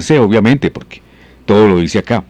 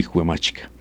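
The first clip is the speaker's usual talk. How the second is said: ordinary talk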